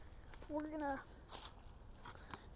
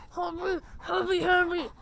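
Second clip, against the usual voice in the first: louder sing-song repetition; excited coo; 7 dB louder than usual. Real, not acted. Cheering up the happy dog, excited sing-song. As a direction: bounce the words for the dog.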